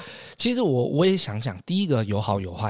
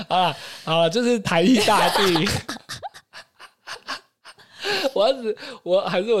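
louder, laughing it off; 9 semitones higher, 4 dB louder than usual. Real, not acted. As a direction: laugh while conceding. Caught out, laughing it off. (笑着认输)

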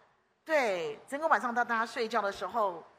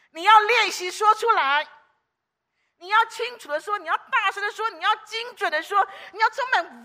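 fiery preaching; 8 semitones higher, 8 dB louder than usual. Acted. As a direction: drive each line home to the congregation. Preaching at full force. (激昂讲道)

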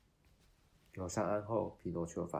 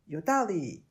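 higher, much louder eager agreement; eager puppet; 9 semitones higher, 12 dB louder than usual. Acted.

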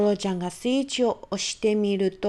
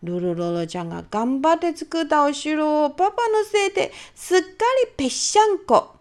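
ordinary delivery

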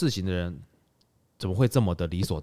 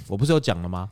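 ordinary delivery

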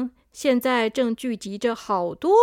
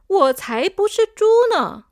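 higher and louder indignant cry; loud protest; 6 semitones higher, 6 dB louder than usual. Acted. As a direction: protest loudly that you're not a pig. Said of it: "很想大声地说".

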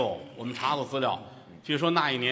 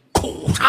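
mock sound effect; 7 dB louder than usual. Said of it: "生孩子哭".

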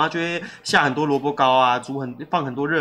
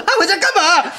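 high, loud and laughing, can't believe he went home; incredulous laughing outburst; 11 semitones higher, 6 dB louder than usual. Retold or imitated. Telling a friend how she reacted when the employee ran home, loud laughing disbelief. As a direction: blurt it out in disbelief through laughter. Retelling scolding the runaway employee.

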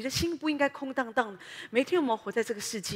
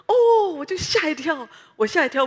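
startled cry; 6 semitones higher, 12 dB louder than usual. Retold or imitated. Preacher startled by a squirrel on the road, loud yelp. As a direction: jump with fright.

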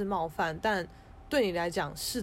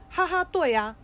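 loud, high laughing agreement; laughing reply; 9 semitones higher, 7 dB louder than usual. Retold or imitated. Boyfriend laughing along with the other person, loud and high. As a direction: quote him chuckling along, loud and high. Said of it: "他还说".

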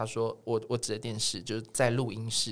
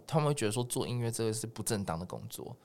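normal talking voice

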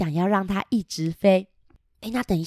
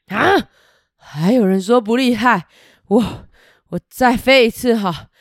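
boastful dragon; 6 dB louder than usual. Acted. Dragon flies once more.

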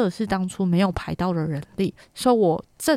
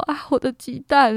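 a bit higher and louder mock yelp; retold alarmed cry; 6 semitones higher, 4 dB louder than usual. After the big fall, retelling her cry about the eggs. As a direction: lightly replay the panic over the eggs. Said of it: "然后我就说".

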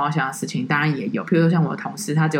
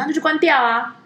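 higher, offhand command; mock bossy tourist; 9 semitones higher than usual. Tourist telling the guide to turn off her headset, offhand and higher. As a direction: toss it off like it's nothing.

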